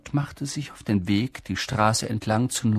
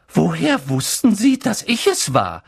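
McGonagall voice; 7 semitones higher, 6 dB louder than usual. Acted. McGonagall surprised question.

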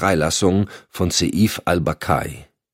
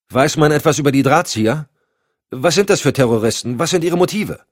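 higher, pressing volley of questions; pressing, raised-pitch interrogation; urgent questioning; 10 semitones higher, 4 dB louder than usual. A narrator's reading. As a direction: blurt out questions held back too long. Cotton blurts out burning questions.

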